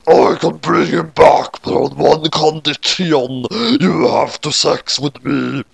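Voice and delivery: slow, put-on monster voice; Shinigami king. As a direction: play the death god bargaining slowly.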